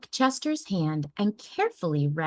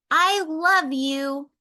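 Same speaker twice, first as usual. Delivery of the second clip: put-on high, slow tender child voice; gentle child I love you; 9 semitones higher, 5 dB louder, slower than usual. A narrator's reading. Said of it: "with a gentle".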